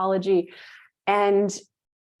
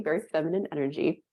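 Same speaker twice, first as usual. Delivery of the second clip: ordinary talk, nothing marked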